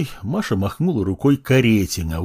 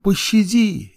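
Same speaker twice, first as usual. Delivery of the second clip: high, desperate plea; begging in fear; 11 semitones higher, slower than usual. Acted. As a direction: beg for mercy. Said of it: "молились они".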